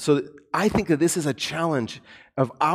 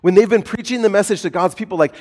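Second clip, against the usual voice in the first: usual speaking voice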